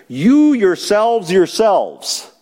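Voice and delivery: You hit first, slow and deliberate, yourselves repeated; emphatic you yourselves. Shown as a demonstration. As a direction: drive home the You yourselves, yourselves. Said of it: "in the middle for emphasis".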